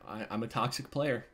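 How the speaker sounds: very low, soft admission with pauses; hushed confession. Real, not acted. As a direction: confess it under your breath, deep and quiet. Mock-solemn confession ending the video.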